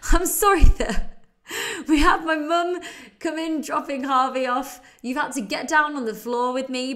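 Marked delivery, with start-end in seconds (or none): 0.0-4.8 laughing. Real, not acted. Laughing apology about interruption.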